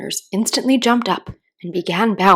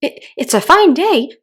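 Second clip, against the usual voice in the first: plain reading voice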